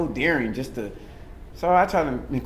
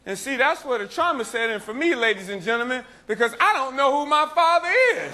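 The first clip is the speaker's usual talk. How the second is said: higher-pitched, projected stage storytelling; stand-up performance; 13 semitones higher than usual. Acted. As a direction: work the crowd with a mock confession.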